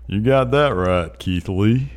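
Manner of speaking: slow put-on Southern drawl; Southern accent. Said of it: "that's a really good Southern accent".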